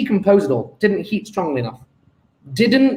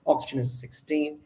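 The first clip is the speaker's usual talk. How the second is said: ordinary talk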